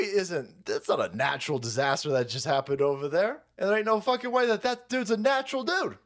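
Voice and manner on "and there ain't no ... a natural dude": usual speaking voice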